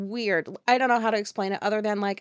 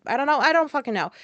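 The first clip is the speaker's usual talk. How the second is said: exasperated outburst; 5 semitones higher, 5 dB louder, faster than usual. Real, not acted.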